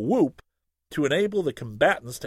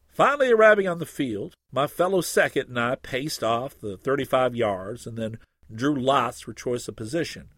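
usual speaking voice

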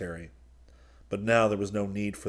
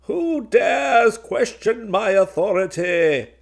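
far higher, much louder, slow furious demand; raging; 13 semitones higher, 10 dB louder, slower than usual. A narrator's reading. Golgotha turning on his men in rage, screaming. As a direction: shake with rage on every word. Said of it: "Shaking with rage".

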